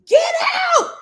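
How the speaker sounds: retold yell, get out; screaming at the man to leave. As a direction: yell him out the door. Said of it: "I'm screaming at the top of my lungs".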